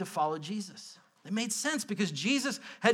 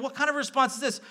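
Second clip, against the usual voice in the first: ordinary delivery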